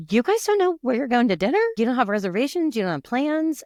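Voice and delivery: high, quick incredulous mom questions; fretting mom. Retold or imitated. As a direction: rattle off worried questions as a retold mom. Retelling an anxious mom grilling teens.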